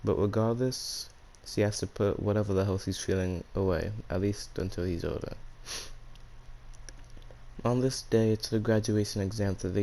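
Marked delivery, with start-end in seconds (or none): none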